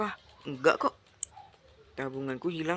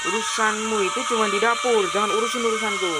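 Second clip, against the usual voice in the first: raised, louder husband's rebuke; angry scolding; 3 semitones higher, 4 dB louder than usual. Acted. Husband telling wife to keep to kitchen, scolding. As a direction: put the wife in her place, pitched up.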